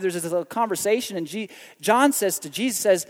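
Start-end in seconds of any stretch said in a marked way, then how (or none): none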